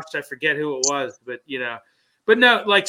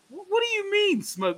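baffled question. Retold or imitated. Teammate baffled by the saying.

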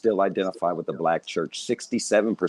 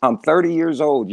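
higher, louder insistence; indignant protest; 6 semitones higher, 5 dB louder than usual. Retold or imitated. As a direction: insist on being grown, voice lifted. Repeated indignant retort.

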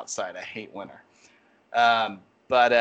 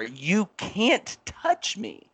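retold yell; 8 semitones higher, much slower than usual. Retelling his warning to a customer who kept coming, mock yell. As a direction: re-enact a yell, stretching each word high. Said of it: "I actually yelled at her".

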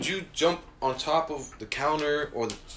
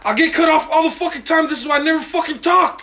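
loud, higher, heated complaint; angry rant; 10 semitones higher, 8 dB louder than usual. Real, not acted. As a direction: vent loudly at friends. Fed up with interruptions.

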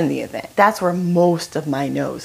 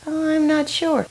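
high, wavering-minded reply; mock hesitation; 10 semitones higher than usual. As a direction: say it hesitantly, pitched up. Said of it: "why are we hesitating?".

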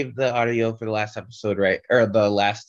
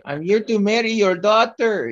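mock Spanish accent; 9 semitones higher, 4 dB louder than usual. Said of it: "some kind of Spanish accent".

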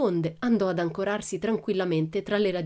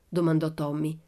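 ordinary delivery, nothing marked

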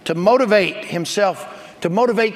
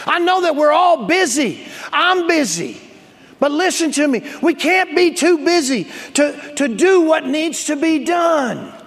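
full-force preaching; 5 semitones higher than usual. Acted. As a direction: build the sermon to a fiery climax. Preacher urges busy congregation.

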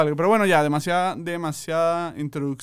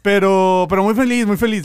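excited; 3 semitones higher, 9 dB louder than usual. Real, not acted. Host stresses his happiness.

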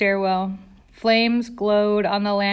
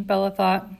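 ordinary delivery

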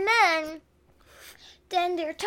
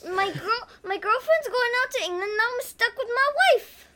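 high whiny lament; high mock lament; lovesick whine; 7 semitones higher than usual. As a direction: whine high about love. Voicing lovesick character.

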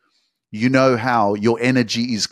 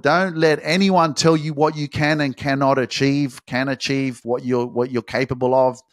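ordinary talk, nothing marked

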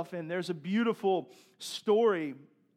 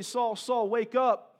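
raised, higher call to wake a sleeper; mock yell, louder and higher; 6 semitones higher, 6 dB louder, slower than usual. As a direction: act out calling across an enemy camp, half-yelled. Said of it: "he yells at Saul".

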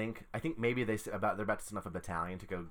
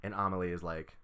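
usual speaking voice

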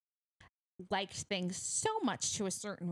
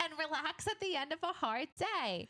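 laughing; 10 semitones higher than usual. Real, not acted. Laughs mid ad read.

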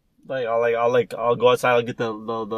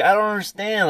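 ordinary talk

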